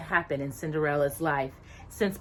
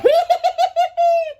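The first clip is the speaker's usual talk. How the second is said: high shrieking giggle; 10 dB louder than usual. Acted. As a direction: giggle fit, much higher and louder. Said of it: "into a fit of giggles".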